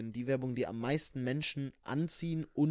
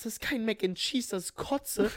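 disgusted; 9 semitones higher than usual. Retold or imitated. Retold disgusted reaction.

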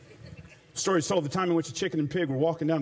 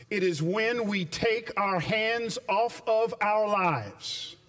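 normal talking voice

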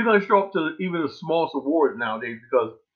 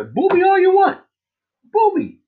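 loud, high taunting challenge; defiant yell; 6 dB louder than usual. Real, not acted. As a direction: dare them to boo you. Daring the audience to boo.

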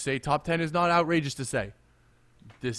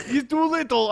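put-on Eastern European accent; 11 semitones higher, 5 dB louder than usual. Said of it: "in his Eastern European accent".

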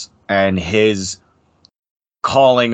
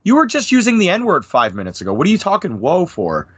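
pitched up high in mock disbelief; incredulous comeback; 7 semitones higher than usual. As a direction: scoff at their hypocrisy, voice jumping up. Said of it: "And it's like".

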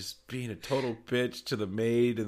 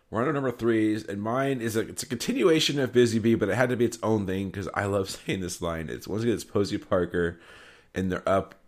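usual speaking voice

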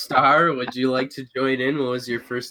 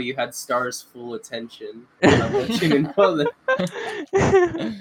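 laughing; 2 semitones higher than usual. Real, not acted. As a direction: laugh through the tease. Laughing while teasing.

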